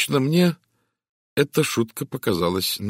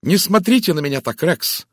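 higher, louder challenging voice; defiant; 10 semitones higher, 8 dB louder than usual. A narrator's reading. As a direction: stand up to him defiantly. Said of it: "ясный и вызывающий голос Терезы".

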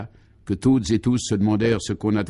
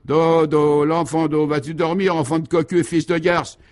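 high, raised, furious mothering; bawled lullaby; 6 semitones higher, 4 dB louder than usual. A narrator's reading. Young mother rocking her baby to sleep, vociferating. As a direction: bawl the lullaby as abuse. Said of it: "berce son petit enfant et vocifère à tue-tête".

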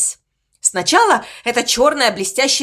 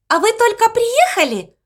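squeaky voice; 10 semitones higher than usual. Acted. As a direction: squeak it out dreamily. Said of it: "песклявым голосом". (писклявый голосок)

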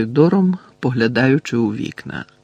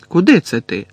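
higher, mildly taken-aback question; surprised, disappointed; 4 semitones higher, 4 dB louder than usual. A narrator's reading. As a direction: ask with a touch of surprised letdown. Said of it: "здивовано й розчаровано".

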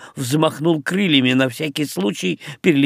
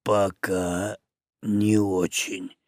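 low, slow, much softer orc voice; slowly; 6 semitones lower, 7 dB quieter, much slower than usual. Acted. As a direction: drag the words like a baffled orc. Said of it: "медленно проговорил орк".

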